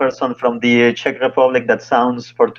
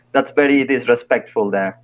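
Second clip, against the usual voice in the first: ordinary talk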